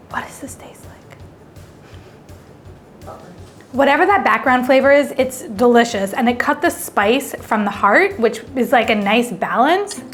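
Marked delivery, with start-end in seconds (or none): none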